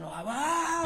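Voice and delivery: high, laughing wow; overwhelmed with delight, half laughing; amazed gasp. Retold or imitated. Comedian recalling first walking on stage, amazed.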